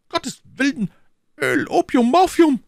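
high, loud cries of pain; tortured man begging for drugs; crying out in agony. A narrator's reading. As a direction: scream for relief. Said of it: "rief er".

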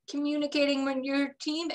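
usual speaking voice

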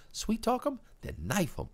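put-on very high, insistent kid voice; Kuno's intense kid voice. Kuno pressing the cop about the killer, high. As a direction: push it hard at the cop.

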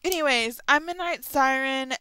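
ordinary talk

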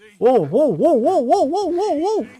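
high, wavering run of "wow"s climbing in pitch; startled "whoa". Real, not acted. Rider on a bucking horse, startled whoas. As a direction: ride out a bucking horse with rising cries. Horse bucks under him.

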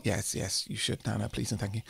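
muttered low reply to the grandma; quiet aside. Real, not acted. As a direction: answer her under your breath. Replies to Nana aside.

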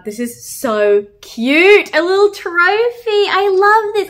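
high, loud, slow delight; gushing. Real, not acted. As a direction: squeal with joy over a gift. Delighted by the trophy.

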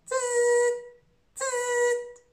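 high buzzing zzz twice; balloon hiss. Shown as a demonstration. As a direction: buzz like a balloon letting air out. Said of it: "then you will create sound".

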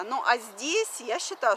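ordinary delivery

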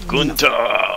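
choked-off Gunta; choking sound. Real, not acted. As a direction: choke on the word. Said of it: "It sounded like you were choking".

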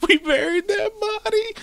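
put-on high, wailing old man's voice; a sobbing elderly stepfather confessing; crying old stepdad. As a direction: whimper it like a broken old man. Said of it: "starts remembering it and starts crying".

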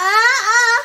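high, loud wail of protest; pained cry. Retold or imitated. Patient to x-ray guy during the scan, pained cry. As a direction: cry out loudly, high-pitched.

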